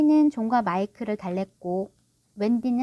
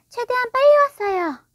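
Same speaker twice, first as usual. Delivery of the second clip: put-on high, loud girl's voice, lightly hurried; eager daughter just arrived, mildly breathless act; out of breath; 13 semitones higher, 7 dB louder than usual. A narrator's reading. Wendy running in with the medicine glass, out of breath. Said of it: "웬디가 숨을 헐떡거리며 말했다".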